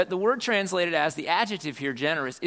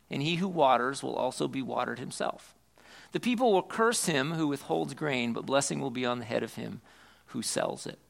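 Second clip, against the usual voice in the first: solemn scripture reading; 4 semitones lower than usual. Acted. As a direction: read it as holy text, unhurried. Reads the Scripture aloud.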